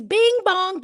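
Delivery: high, loud mock subway chime; imitated door chime. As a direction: sing out the two-note door signal mockingly. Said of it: "that's not what that sound is".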